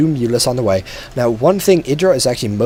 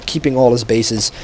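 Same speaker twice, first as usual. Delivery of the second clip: ordinary delivery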